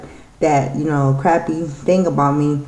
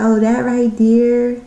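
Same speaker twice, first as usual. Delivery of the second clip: slow, higher, drawn-out mock disapproval; mock disapproving neighbor; 6 semitones higher, slower than usual. Neighbor frowning at my eyeshadow, drawn out. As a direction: point at it like it offends you.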